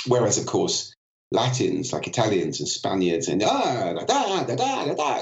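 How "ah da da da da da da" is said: high, swooping Italian or Spanish melody; lively Latin prosody. Retold or imitated. Speaker contrasting Latins with Scandinavians, lively Latin sing-song. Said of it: "much more lively and up and down".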